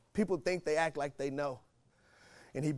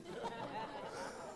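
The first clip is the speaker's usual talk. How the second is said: laugh; 12 dB quieter than usual. Real, not acted. Laughs at own joke.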